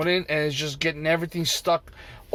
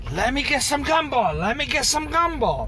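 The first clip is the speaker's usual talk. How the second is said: mocking customers; 9 semitones higher than usual. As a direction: mimic the customers' constant asking. Customers quoted asking for gumbo.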